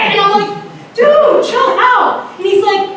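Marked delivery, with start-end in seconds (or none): 1.0-2.3 high, drawn-out exasperation; exasperated plea. Retold or imitated. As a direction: stretch it out high, pleading for sense. Retold plea to calm friend.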